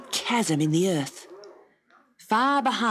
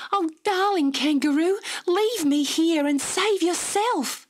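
crying out; 11 semitones higher than usual. Acted. Dot begging the kangaroo before the leap, high plea. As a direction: beg her to go on without you. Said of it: "Again Dot cried".

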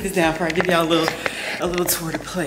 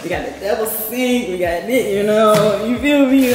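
higher and louder, hyped up; excited showing off; 5 semitones higher, 8 dB louder than usual. Real, not acted. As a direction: sell it like your dream kitchen. Showing off favourite kitchen.

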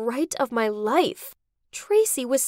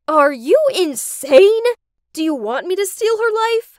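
outraged; 7 semitones higher, 8 dB louder than usual. Acted. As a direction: hurl it at her in disbelief. Daughter confronts scheming mother.

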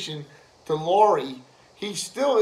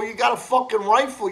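ordinary delivery

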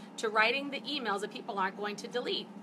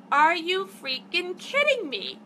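slow, higher and louder, in disbelief; indignant outburst; 8 semitones higher, 6 dB louder, slower than usual. Retold or imitated. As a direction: let the outrage stretch each word. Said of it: "That's what I felt like saying".

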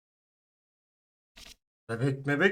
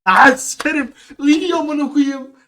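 disgusted groan; 9 semitones higher, 8 dB louder, slower than usual. Real, not acted. Disgusted at the screaming baby.

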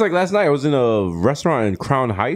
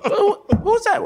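mock confusion. Retold confused reaction.

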